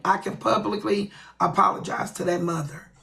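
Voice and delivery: normal talking voice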